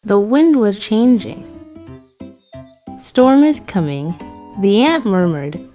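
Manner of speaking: plain reading voice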